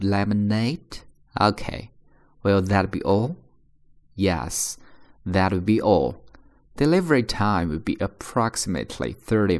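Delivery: slow, careful read for imitation. Shown as a demonstration. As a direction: read each line slowly and clearly. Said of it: "我再重新去放慢的速度读一遍". (放慢速度朗读)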